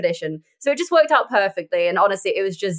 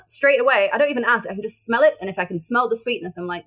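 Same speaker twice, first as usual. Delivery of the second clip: ordinary talk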